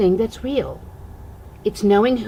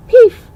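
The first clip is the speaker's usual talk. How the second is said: the peef; 12 semitones higher, 12 dB louder than usual. A narrator's reading. Magic sound in bedtime story.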